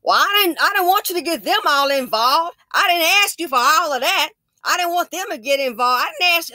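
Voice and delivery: high, loud, indignant protest; defensive protest. Retold or imitated. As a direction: snap back, offended and caught out. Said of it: "she changed to".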